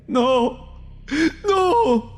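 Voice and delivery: very high cry of grief, rising; anguished scream. Acted. Brother seeing his sister die, high anguished scream. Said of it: "grité, dejándome lo último que quedaba de mi".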